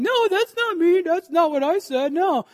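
high, mock-defensive denial; defensive protest. Believer mocks taking insults personally, high defensive whine. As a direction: play the offended believer, voice jumping up high. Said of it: "and you're like".

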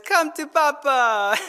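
put-on drawn-out playful call to a child; coaxing dad. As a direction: voice a dad luring his little child. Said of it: "then the dad says".

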